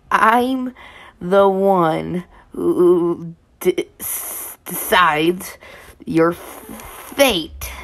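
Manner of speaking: slow, breathy taunting threat; drawn-out menace, stumbling a little; menacing villain voice. Acted. Toby menaces narrator.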